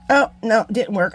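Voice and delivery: real groan at the failure; dismayed oh no. Real, not acted. Craft attempt fails on camera.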